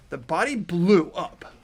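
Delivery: ordinary talk, nothing marked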